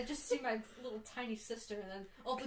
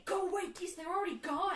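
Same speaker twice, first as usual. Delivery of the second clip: mock shout; 9 semitones higher, 6 dB louder than usual. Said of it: "she kept saying".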